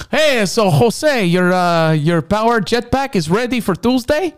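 high, slow put-on phone voice; mock customer-service voice; mock caller voice. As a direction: play a chirpy clerk calling. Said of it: "I thought it was maybe".

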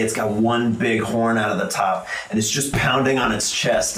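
usual speaking voice

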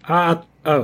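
ordinary talk

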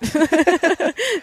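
high burst of laughter. Real, not acted. Guest joking about smelling like meat, high laugh. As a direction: laugh at your own joke.

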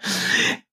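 breathy burst of laughter. Real, not acted. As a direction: crack up at your own punchline. Laughing at the story's punchline.

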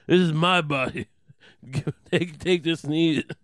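hammed-up drunk Dice Clay voice, loud; brash drunken boast, breaking into laughs; drunk Judas. Drunk Judas mimics Jesus at supper, brash mock Dice voice. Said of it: "he's drunk, so he's just like".

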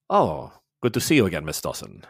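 lightly voiced, low smug German officer; a hint of a cold, low villain greeting her by name; Mueller greeting. GM as Mueller surprising Faye from behind, low and smug. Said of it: "you hear".

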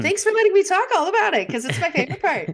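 high, fast, giddy squeal; gushing excitement. Real, not acted. Thanking co-host for her favourite part, gushing excitedly. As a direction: rush it out in a high, delighted burst.

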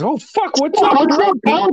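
excited greeting. Retold or imitated. Greeting a returning cousin, high and excited. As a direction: greet a friend just back home. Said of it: "your Mark goes".

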